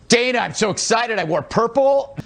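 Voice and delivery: ordinary delivery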